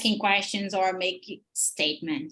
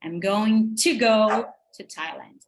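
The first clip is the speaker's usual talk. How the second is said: slow, with a big stress on GO, then THAILAND; exaggerated sentence stress; 3 dB louder than usual. Shown as a demonstration. Teacher demonstrating rhythm to students, exaggerated stress on go. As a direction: overdo the beat on "go" and "Thailand". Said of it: "Eu tô fazendo bem exagerado".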